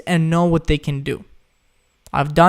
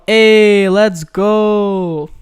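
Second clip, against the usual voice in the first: celebratory shout; 3 semitones higher, 6 dB louder, much slower than usual. Real, not acted. Host hyping tomorrow's start to viewers, loud cheer. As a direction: cheer it like a kickoff.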